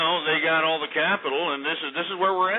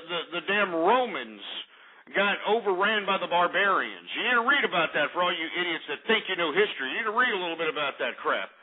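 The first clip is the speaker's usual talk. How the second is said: ordinary delivery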